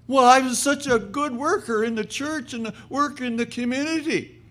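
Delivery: high-pitched pleading woman; indignant protest. Acted. Woman protesting to the angel about purgatory, high and aggrieved.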